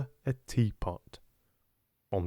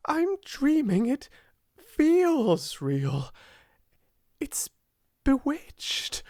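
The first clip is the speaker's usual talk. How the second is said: high, breathy rapture with gasps; breathless wonder; 11 semitones higher than usual. A narrator's reading. Girl turning round in the enchanted room, breathless wonder. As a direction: marvel aloud, pitch soaring. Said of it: "Her words began to hurry themselves".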